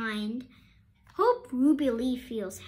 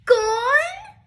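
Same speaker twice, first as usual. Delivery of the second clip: high, loud disbelieving cry; shocked teacher demanding; outraged disbelief; 11 semitones higher, 9 dB louder than usual. A narrator's reading. Teacher shocked at lost note.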